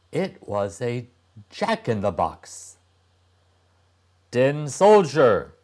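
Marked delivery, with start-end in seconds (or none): 4.3-5.5 loud, higher troll calling out; the troll snaps a warning. A narrator's reading. Troll warns the tin soldier, loud and high. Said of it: "the troll said".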